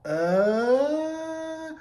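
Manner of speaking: high, wounded mock "uh?"; a hurt little stunned noise; stunned grunt. [name] stunned by June's jab.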